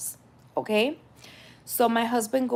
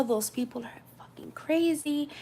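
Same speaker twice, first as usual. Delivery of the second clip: whispered aside; 5 semitones higher, 6 dB quieter than usual. Retold or imitated. Son-in-law fuming, retelling his words.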